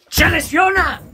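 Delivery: shouting in disbelief; furious shout. Real, not acted. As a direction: yell it out in outrage. Furious rant about injury.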